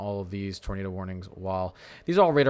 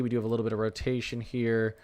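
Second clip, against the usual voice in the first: ordinary talk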